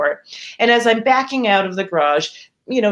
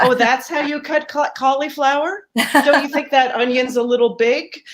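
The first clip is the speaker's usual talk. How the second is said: higher, nitpicking mother voice; a hint of a judgy mother; mocking critical mother; 7 semitones higher than usual. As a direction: mimic a fussy critical mom.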